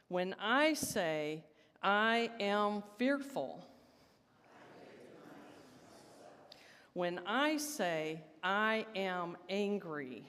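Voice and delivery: slow, measured leading line for the room to echo; slow call and response. Shown as a demonstration. Leading the congregation in call and response, slow and measured. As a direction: draw each word out so the room can answer. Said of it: "do this as a call and response".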